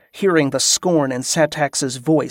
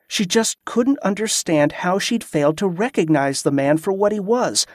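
plain reading voice

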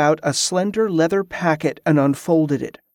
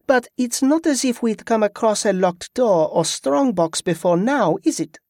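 lightly raised, teasing wife; teasing wink; 6 semitones higher than usual. A narrator's reading. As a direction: tease your husband with a wink. Yvette teasing.

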